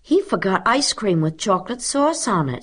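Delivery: high-pitched, prompt pupil; quick-answering schoolgirl. A narrator's reading. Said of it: "said Mary quickly".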